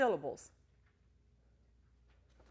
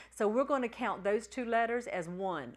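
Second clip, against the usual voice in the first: normal talking voice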